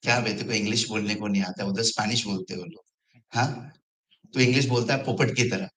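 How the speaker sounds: fast teasing Hindi jab; mocking friend. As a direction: rattle off the taunt quickly. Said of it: "My friend used to make fun of me".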